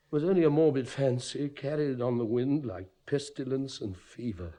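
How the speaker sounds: usual speaking voice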